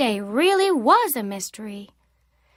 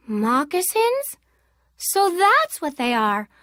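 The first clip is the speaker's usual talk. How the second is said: delighted; 5 semitones higher than usual. A narrator's reading. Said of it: "I declared with delight".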